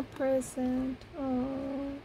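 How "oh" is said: ordinary talk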